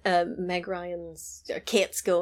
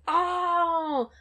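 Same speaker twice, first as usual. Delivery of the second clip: put-on suave French accent, higher; 9 semitones higher, slower than usual. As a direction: say it like a smooth Frenchman. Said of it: "And he's like".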